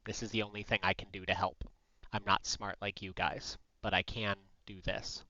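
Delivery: low, soft, resigned in-character voice; subdued, earnest martyr, pitched low; self-sacrificing hero. Acted. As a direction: quietly take the blame and offer yourself. Plays their character offering to die.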